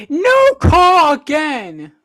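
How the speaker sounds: loud, high, drawn-out protest; slow, incredulous commentator yell; outraged shout. Real, not acted. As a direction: cry out at the referees' missed call. Protesting a missed foul call.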